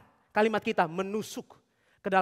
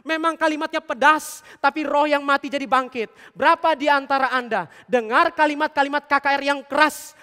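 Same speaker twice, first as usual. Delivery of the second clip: louder, driving preacher's build to the congregation; preaching at full force; 3 semitones higher, 6 dB louder than usual. Acted. Preacher to the congregation, loud and insistent. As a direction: hammer the point home from the pulpit. Preaches hard words save souls.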